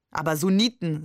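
ordinary delivery, nothing marked

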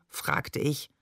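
plain reading voice

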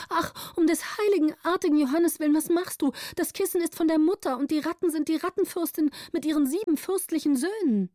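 high, pleading and rushed; desperate plea. A narrator's reading. Gritta begs the countess to spare the rats, high and pleading. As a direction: beg frantically to save the rats.

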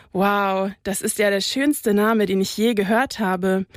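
ordinary delivery

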